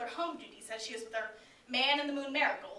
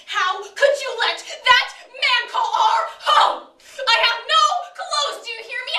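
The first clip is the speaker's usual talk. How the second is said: slow, much higher and louder raging shout; furious shout; 9 semitones higher, 11 dB louder than usual. Acted. Mother berates daughter over principal's call, furious slow shout. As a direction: rage at her, dragging each word.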